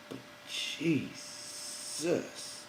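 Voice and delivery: breathless soft whisper, drawn out slow; awed whisper. A narrator's reading. Slim sees the crushed hand, awed whisper. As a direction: breathe it out in shock.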